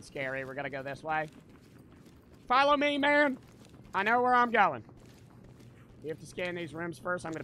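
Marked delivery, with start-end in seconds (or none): 2.5-4.8 loud, high-pitched call to follow; calling out orders. Pretend. Player leading the team through the game, calling out loudly. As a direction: shout ahead to the squad.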